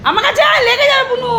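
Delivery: urgent call. Real, not acted. Calling pet away from fight.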